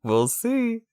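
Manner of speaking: high, far-off taunt; smug teasing voice. Pretend. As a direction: sing it smugly, way up high. Teasing after winning debate.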